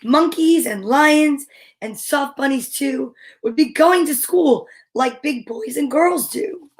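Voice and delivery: measured, rhythmic picture-book narration; storybook read-aloud. A narrator's reading. Reader reading preschool story to little kids, measured rhythmic narration. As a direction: read the rhyme slowly to small children.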